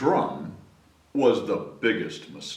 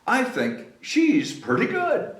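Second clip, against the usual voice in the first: proud father; 13 semitones higher than usual. A narrator's reading. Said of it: "said her father".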